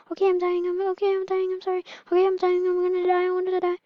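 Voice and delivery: high, frantic acted wail; dying baby. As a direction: cry out in a high pretend voice.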